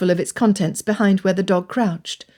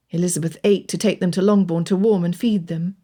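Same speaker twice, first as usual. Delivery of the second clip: ordinary delivery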